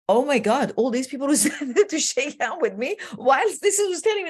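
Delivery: amazed excitement. Retold or imitated. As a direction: relive the rush of the standing ovation. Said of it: "I came down and I thought".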